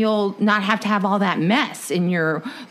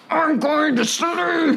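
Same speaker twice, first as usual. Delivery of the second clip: mock angry sleeper; 8 semitones higher, much slower than usual.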